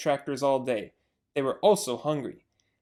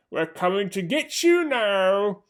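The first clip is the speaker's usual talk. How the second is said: slow menacing taunt; 3 semitones higher, 4 dB louder, slower than usual. Acted. Bunce taunting fox.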